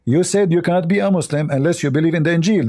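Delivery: ordinary talk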